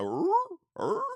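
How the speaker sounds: high imitated dog growl; mock dog growl. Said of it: "The dog's like".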